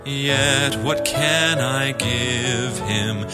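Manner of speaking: normal talking voice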